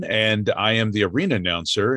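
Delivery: normal talking voice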